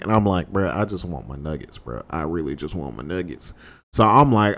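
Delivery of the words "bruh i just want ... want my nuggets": low, soft grumble; muted plea. Acted. Cornered roommate who only wants his nuggets, low muted plea. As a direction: plead under your breath, low and soft.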